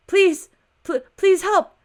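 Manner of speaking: high, loud desperate begging; panicked plea. Acted. Woman begging the officer for help, panicked and high. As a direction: beg for help in a rising panic.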